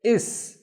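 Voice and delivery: il said as "is", l turned to s; sun letter. Shown as a demonstration. Teacher correcting il before sa. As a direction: swap il for is. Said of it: "we replace il with".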